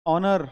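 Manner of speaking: "honor" said loud with a silent h, rising at the end; "honor" starting on the vowel, no h; honor said alone. Shown as a demonstration. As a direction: drop the h in "honor". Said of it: "इसमें भी एच silent होता है".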